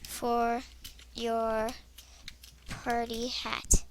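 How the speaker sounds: slow, soft dictation while typing; quiet slow spelled-out words; typing aloud. Real, not acted. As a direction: read out as you type.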